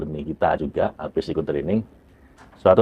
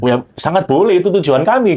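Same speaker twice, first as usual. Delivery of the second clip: high, louder imitated approval; retold eager consent; 10 semitones higher, 6 dB louder than usual. Agency head granting the trainer's request, eager. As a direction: voice the official's ready yes, pitched up. Official gladly agrees in retelling.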